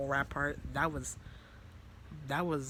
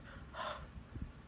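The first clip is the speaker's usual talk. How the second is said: gasp; 11 dB quieter than usual. Retold or imitated. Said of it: "I'm like".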